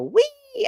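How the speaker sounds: high, squealed "wee"; imitated gleeful whee. Imitating friend on a wheeled chair, gleeful squeal. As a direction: mimic a kid's squeal on a ride. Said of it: "and go".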